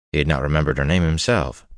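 usual speaking voice

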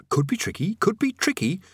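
a touch of nervous haste; hurried hedging. A narrator's reading. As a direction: hedge hastily as you back away. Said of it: "Rincewind said hurriedly".

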